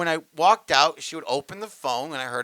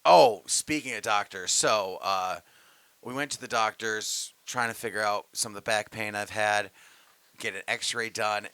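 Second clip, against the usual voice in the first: ordinary talk, nothing marked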